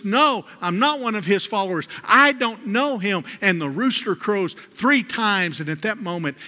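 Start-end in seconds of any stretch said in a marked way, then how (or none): 0.0-3.2 higher, louder denial, slowing at the end; yelling denial. Retold or imitated. Peter to the crowd, denying Christ with a mock yell. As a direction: cry it out, caught and desperate. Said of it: "Peter yells out and cries out".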